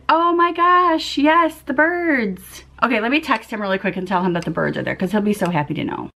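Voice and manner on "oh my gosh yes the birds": high, slow, stretched-out gushing; delighted exclamation. Real, not acted. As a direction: coo it high and drawn out, overjoyed. Delighted at birds at the feeder.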